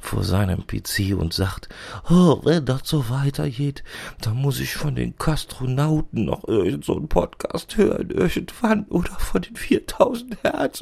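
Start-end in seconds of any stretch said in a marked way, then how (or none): 2.1-10.8 much higher whining imitation of the boss; mock weepy whine. Assistant imitating the boss crying at his PC, high mock whine. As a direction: whimper it like a crybaby, pitch climbing high. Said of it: "dann sitzt er da weinend".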